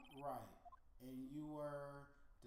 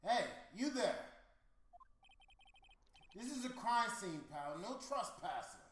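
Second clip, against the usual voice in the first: louder, higher gruff-cop warning; tough cop voice; 6 semitones higher, 8 dB louder than usual. Acted. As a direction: play the cop shooing an intruder off the scene. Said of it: "bring out the accent".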